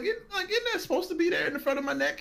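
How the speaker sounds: incredulous question. Retold or imitated. Retelling confused reply to doctor.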